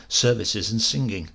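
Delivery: ordinary delivery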